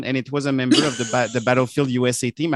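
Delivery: normal talking voice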